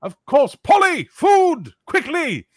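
very high, loud call to the kitchen; high loud servant call; calling to Polly. Acted. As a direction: shout the order out back. Innkeeper shouts to servant.

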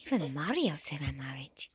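fast secret whisper. Acted. Yoshi confiding to the child in a quick whisper. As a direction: let them in on a secret, breathless. Secret aside while teasing.

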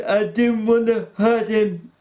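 Lenny crying. A narrator's reading. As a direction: cry it out. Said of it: "Lenny cried".

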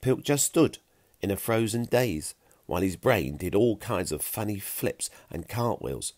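ordinary delivery

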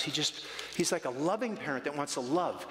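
usual speaking voice

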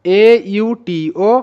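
letters one at a time for learners; slow spelling. Shown as a demonstration. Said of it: "অটো". (ধীরে ধীরে বানান করে বলা)

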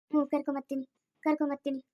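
ordinary delivery

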